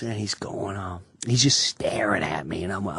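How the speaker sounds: dropping to a near whisper; tense low murmur while being stared at; hushed aside. Retold or imitated. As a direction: hush it like you're scared to be heard. Tense hush while Pesci stares.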